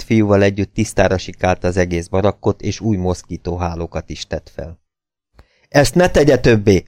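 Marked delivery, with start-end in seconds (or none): 5.7-6.8 higher-pitched mild shout of reproach; narrated cry of disapproval; sharp exclamation. A narrator's reading. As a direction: call out a prohibition, pitched up. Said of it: "kiáltott rá Nelson".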